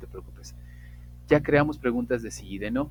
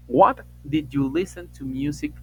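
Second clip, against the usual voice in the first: slow model question; 5 semitones higher, 6 dB louder, slower than usual. Shown as a demonstration. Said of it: "creo una pregunta y digo".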